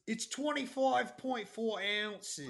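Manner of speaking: broad Australian voice; Australian accent. Acted. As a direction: put on an Aussie accent, pitch swinging up. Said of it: "You got a good Australian accent".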